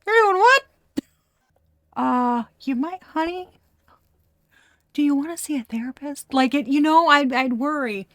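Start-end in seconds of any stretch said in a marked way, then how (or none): 0.0-0.6 much higher, louder mock-shocked yelp; hammed-up jaw-drop at a friend's plan; playful outraged what; shocked disbelief. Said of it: "I'd be like". 2.0-6.3 higher, hesitant mock-worried suggestion; gently steer a friend toward therapy, playful; teasing worried friend, higher; mock concern. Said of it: "I'd be like".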